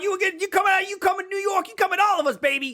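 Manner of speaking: high, hyped mock New York accent; New Yorker. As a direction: sell it like a Brooklyn hype man.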